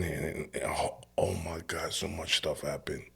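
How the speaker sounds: hushed, breathless whisper. Real, not acted. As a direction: drop to a whisper, overwhelmed by memory. Recalling dangerous street life.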